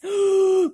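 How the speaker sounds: sharp loud alarmed gasp. A narrator's reading. Travellers gasping as they near the rainbow. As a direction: suck in air in fright.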